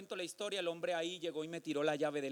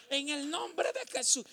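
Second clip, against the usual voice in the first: praying; 10 semitones higher, 5 dB louder, slower than usual. Retold or imitated. Starts praying over the man.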